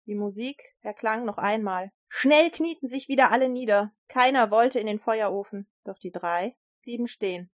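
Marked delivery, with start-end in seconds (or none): none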